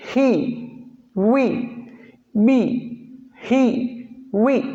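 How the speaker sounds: ही and वी said short and clipped; English example words. Shown as a demonstration. Teacher listing ही, वी words, short vowels. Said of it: "जैसे देखिएगा".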